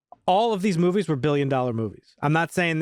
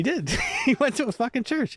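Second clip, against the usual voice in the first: laughing; 10 semitones higher than usual. Real, not acted. Laughing at absurd movie scene.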